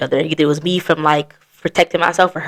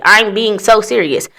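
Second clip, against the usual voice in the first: usual speaking voice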